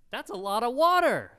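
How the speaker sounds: loud, high exclamation of amazement; amazed exclamation. Real, not acted. Preacher marvels at water for a million people, amazed. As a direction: marvel at it at full volume.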